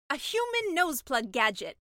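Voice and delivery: excited exclaim. Acted. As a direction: exclaim like finding treasure. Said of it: "the mermaid exclaimed".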